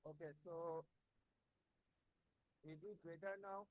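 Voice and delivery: distant, faint voice off the microphone; speaking from across the room, too quiet; far from the mic. Real, not acted. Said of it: "No, sir, not yet".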